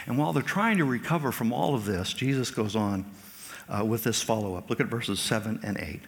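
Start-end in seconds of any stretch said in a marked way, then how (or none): none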